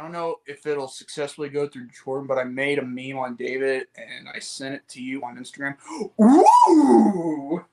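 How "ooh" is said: high, loud drawn-out "ooh"; sustained high-pitched "ooh"; excited "ooh". Pretend. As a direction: whoop a long, high ooh. Exclaims after announcing his meme.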